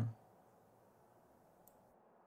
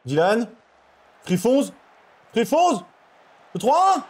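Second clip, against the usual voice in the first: yelling from the sidelines; 8 semitones higher, 4 dB louder, slower than usual. Real, not acted. Calling players, rising.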